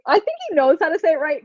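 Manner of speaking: ordinary talk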